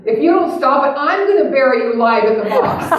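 comic angry outburst; mock threat. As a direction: threaten like the exasperated psychiatrist. Psychiatrist's threatening punchline.

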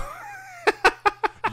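shrieking laugh; high laugh. Real, not acted. Laughing at product joke.

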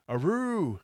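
wolf voice. Acted. Howl shakes the ground.

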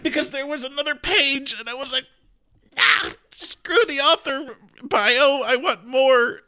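high, loud, teary plea; crying while laughing at herself; choked-up and high; tearful. Real, not acted.

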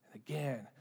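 whispered. Acted. Quiet repeated verse recitation.

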